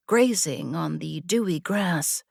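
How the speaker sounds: plain reading voice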